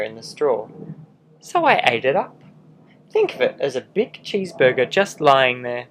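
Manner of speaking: a lightly voiced sly wolf telling his side; charming wolf pleading innocence, animated; wolf narrator. Wolf narrating his own story.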